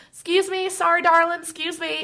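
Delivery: sweet high Southern "excuse me, darling"; polite Southern belle in a crowd; Southern accent. Retold or imitated. Pushing through crowds, put-on Southern accent, high and sweet. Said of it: "talking in a Southern accent".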